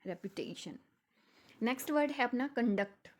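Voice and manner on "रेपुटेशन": रेपुटेशन with the stress on टे, the pitch rising there. Shown as a demonstration. Teacher demonstrating रेपुटेशन with stress on टे. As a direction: lean on the टे of रेपुटेशन. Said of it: "टी पे लगा देंगे".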